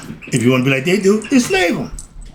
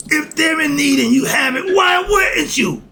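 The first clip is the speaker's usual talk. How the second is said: passionate shout; 11 semitones higher than usual. Real, not acted. Street preacher shouting his case for feeding the needy. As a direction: yell it at the crowd, the last words stretched out slowly.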